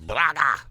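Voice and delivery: "Braga!" barked with a throaty squelch. Shown as a demonstration. Gamer demonstrates how to say "Braga!", throaty and loud. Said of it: "some squelch in your throat".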